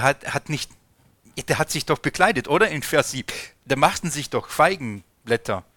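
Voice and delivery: normal talking voice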